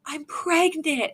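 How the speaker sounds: quiet awe. Retold or imitated. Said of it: "got off the phone and".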